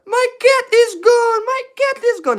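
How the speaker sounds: very high, hammed-up hysterical wail; over-the-top shrieking meltdown. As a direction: scream it in mock panic, stretching the words. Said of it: "all hysterical".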